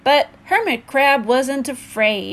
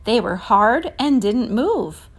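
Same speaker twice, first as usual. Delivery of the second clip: ordinary delivery, nothing marked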